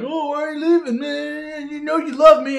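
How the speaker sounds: high, far-off mocking voice of the crew; mocking falsetto whine; mock whiny plea. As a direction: whine it like a clingy friend.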